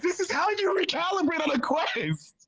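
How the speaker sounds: high-pitched exasperated protest; heated insistence. Real, not acted. Defending the recalibrating to a mocking friend, heated insistence. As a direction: argue it back, pitch jumping up.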